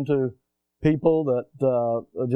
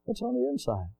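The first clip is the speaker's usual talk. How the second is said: high hushed question; 8 semitones higher, 7 dB quieter than usual. Acted. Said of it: "repeated question, varied".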